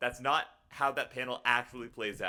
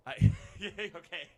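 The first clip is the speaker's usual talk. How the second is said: laughing; 9 semitones higher, 6 dB louder than usual. Real, not acted. Laughing at chat.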